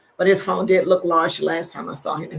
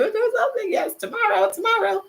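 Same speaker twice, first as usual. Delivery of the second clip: sing-song, higher and quicker; high teasing voice; 4 dB quieter than usual. Acted. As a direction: tease them in a high playful voice. Teasing a viewer about tomorrow.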